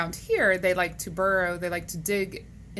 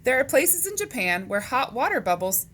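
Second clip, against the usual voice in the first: lively, higher-pitched picture-book reading; read-aloud to children; 5 semitones higher, 3 dB louder, slower than usual. Acted. Teacher reading animal questions to boys and girls, animated read-aloud. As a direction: read it to kids with big pitch swings.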